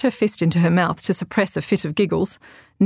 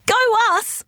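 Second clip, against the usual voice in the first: high excited cry; exclaiming. A narrator's reading. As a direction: burst out with glee. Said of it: "Autumn exclaimed".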